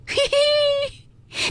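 a high sly "heh heh"; sly chuckle. A narrator's reading. Jia Lian grabs the hair from Ping'er, sly chuckle. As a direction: chuckle as you snatch it. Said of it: "笑道".

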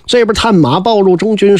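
plain reading voice

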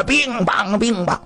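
high-pitched spoken bang-bang; imitated beating noises. Storyteller mimics the husband beating his wife, imitated blows. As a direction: rattle off the blows as sound effects.

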